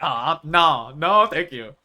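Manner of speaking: loud, firm acted no-thanks; loud mock rejection; mock refusal. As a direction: turn it down emphatically, playing it up.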